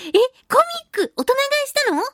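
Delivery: can't believe they bought the whole set; surprised. Real, not acted. To the listener who bulk-bought comics, surprised.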